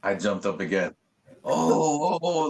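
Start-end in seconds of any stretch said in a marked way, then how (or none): 1.4-2.5 high, excited whoops, moderately voiced; thrilled repeated exclamation; retold crazy hype. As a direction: re-enact jumping up at a second sighting. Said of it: "I was crazy hyped".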